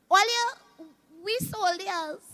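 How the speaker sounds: usual speaking voice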